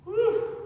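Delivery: sudden high excited whoop. Real, not acted. Coach whoops mid exercise.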